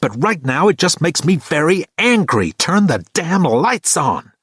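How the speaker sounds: high, slowing command; angry order. A narrator's reading. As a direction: bite off each word. Kate ordering Thor angrily.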